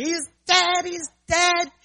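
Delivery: high, loud shriek; retold scream. Kids shrieking that the dog is dead, high and loud. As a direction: wail it like frightened kids. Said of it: "And the kids scream".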